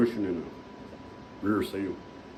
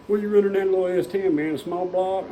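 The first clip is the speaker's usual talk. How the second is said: higher, fast, eager question from a burly stranger; curious stranger; 7 semitones higher, 3 dB louder than usual. Retold or imitated. Burly garage owner quizzing the racer about his engine, eager and curious. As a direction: fire off the question with lively curiosity.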